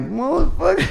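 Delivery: very high, stretched curse of disbelief; the penny drops, squeal it out high; retold dawning shock at being cheated, very high; stunned realization. Realizing promoter cheated him.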